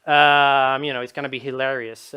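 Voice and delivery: usual speaking voice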